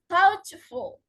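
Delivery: THOUGHTful, loud first syllable, light -ful; suffix left unstressed. Shown as a demonstration. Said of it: "are not usually stressed".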